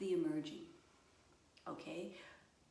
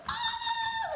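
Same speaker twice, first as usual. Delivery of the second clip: long, loud, very high squeal of excitement; piercing delighted scream, drawn out; excited squeal; 9 dB louder than usual. Shown as a demonstration. Showing how excitement sounds, squealing. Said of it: "What does it talk like?".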